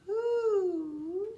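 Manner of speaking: high, drawn-out hooted WHO; owl hoot. Shown as a demonstration. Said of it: "listen to how I'm saying that".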